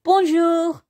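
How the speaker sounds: prim Peter. A narrator's reading. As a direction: chirp it like a goody-goody boy. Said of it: "said perfect Peter".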